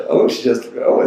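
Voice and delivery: usual speaking voice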